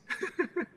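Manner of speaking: quick high chuckle; short high laugh. Real, not acted. Preacher laughs at his own story, short high chuckle. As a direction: laugh at the memory.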